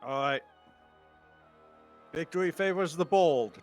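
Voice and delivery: normal talking voice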